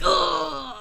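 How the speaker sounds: long high-pitched groan of disgust; hammed-up drawn-out "ugh"; exasperated groan. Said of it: "I'm always like".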